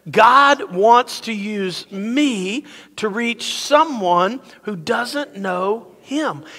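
very slow, measured group-lead declaration; emphatic recitation. Shown as a demonstration. Pastor leading everyone to say it together, emphatic and slow. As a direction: stretch each word so a crowd can join in. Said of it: "Say it like you mean it".

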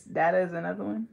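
usual speaking voice

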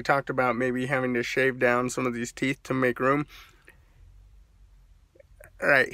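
lip pulled down while talking; speaking with finger at mouth. Real, not acted. Seen 1: Finger presses and pulls lower lip down, showing braces, throughout.

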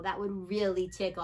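usual speaking voice

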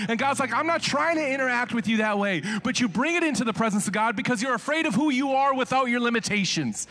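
high, loud preaching at full force. Acted. Preacher urging the congregation, full force. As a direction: drive it home from the pulpit.

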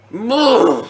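loud drawn-out holler; roaring self-encouragement. Real, not acted. Gamer psyching himself up for the match, drawn-out shout. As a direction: draw out the word in a loud cry.

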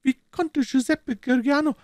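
high, far-off voice of an anxious Italian woman. A narrator's reading. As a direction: ask tensely, as a frightened Italian wife. (ängstliche Italienerin, hohe Stimme)

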